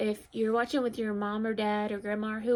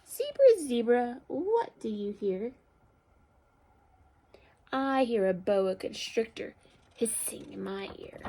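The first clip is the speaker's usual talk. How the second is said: picture-book read-aloud; 3 dB louder than usual. A narrator's reading. Teacher reads animal story to children, expressive storytelling. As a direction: call the animal, then stretch the sound word.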